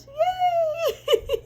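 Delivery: very high laughing-crying yay; joyful squeal. Real, not acted. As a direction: squeal with tearful joy. Cheering own product launch.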